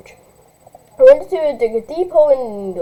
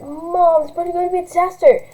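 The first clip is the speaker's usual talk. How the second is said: put-on higher, whining spoiled teen; whiny rich girl; 6 semitones higher than usual. Spoiled girl blaming her mother, lightly whiny. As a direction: complain like a spoiled brat.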